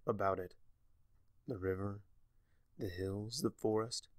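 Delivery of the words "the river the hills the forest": ordinary delivery, nothing marked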